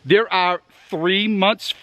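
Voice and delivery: normal talking voice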